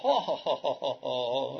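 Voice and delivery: slow, wondering laugh of amazement; astonished laughter. Retold or imitated. Milani laughing in amazement at Dolabi's answer. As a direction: let out an amazed old cleric's chuckle. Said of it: "در مقام ابراز شگفتی گفت".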